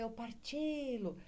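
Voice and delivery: "partilo" softly called, long stressed "ti"; calling out the word. Shown as a demonstration. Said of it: "é a última sílaba que é tônica".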